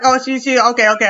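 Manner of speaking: ordinary delivery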